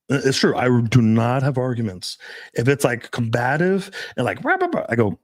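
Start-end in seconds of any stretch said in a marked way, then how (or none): 4.4-4.9 rah rah rah tossed off much higher, like a nagging rant; mock argument noise. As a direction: mimic someone ranting at you, high and mocking. Mocking a combative woman arguing.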